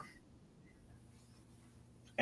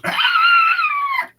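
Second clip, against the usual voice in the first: piercing, very high, loud squeal; panicked creature shriek; 11 dB louder than usual. Pretend. Game master voices serpent pulled underwater, panicked shriek. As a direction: shriek like a beast yanked under.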